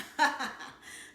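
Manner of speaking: airy burst of laughter; breathy laugh. Acted. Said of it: "Mama and Dad cracked up".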